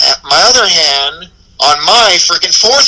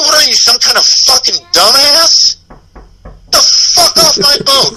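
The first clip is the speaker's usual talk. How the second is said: retold angry yell; 10 semitones higher than usual. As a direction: bark it at him, then laugh. Angry retort to drunk friend.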